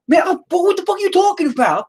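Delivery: high-pitched mock New York ranting; mad New York accent. Husband mimicking his wife mid-argument, shrieky-high New York accent. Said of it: "my wife has that accent when she gets mad at me".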